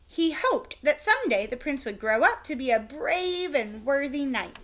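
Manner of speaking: ordinary delivery, nothing marked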